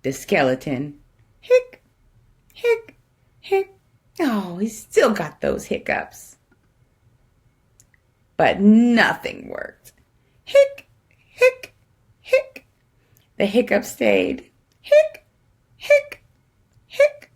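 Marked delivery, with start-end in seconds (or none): 1.4-3.8 high squeaky hic hic hic; squeaky hiccups. Acted. Skeleton hiccuping to the kids, high squeaky hics. 10.5-12.7 high squeaky hic hic hic; squeaky hiccups. Acted. Skeleton hiccuping to the kids, high squeaky hics. 14.8-17.3 high squeaky hic hic hic; squeaky hiccups. Acted. Skeleton hiccuping to the kids, high squeaky hics.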